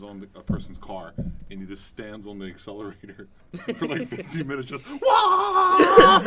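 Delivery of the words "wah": loud high laughing wah; laughing imitation of an engine roar; mock engine roar. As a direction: shout it like a revving car. Uncle revving car in story.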